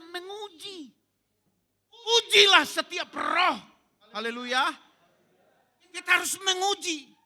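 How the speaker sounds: preaching that climbs to a high, loud shout; fiery preaching. Acted. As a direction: build up to a loud exhortation to the congregation. Preaches at rising full force. (khotbah berapi-api, makin tinggi dan lantang)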